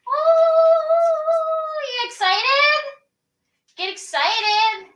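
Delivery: high, loud sing-out with a long whoop; excited greeting. Real, not acted. Host to cast before opening night, excited greeting. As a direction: hype up the whole cast.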